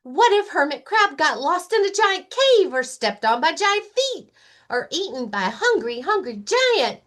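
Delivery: high, breathless fretting bunny; worried Rabbit. Acted. Rabbit fretting to Lion about giants, high and anxious.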